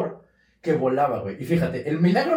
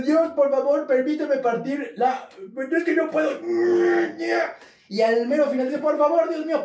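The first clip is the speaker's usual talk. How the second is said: mock tearful prayer; 8 semitones higher than usual. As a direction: beg God in a hammed-up sob. Saint praying in the air.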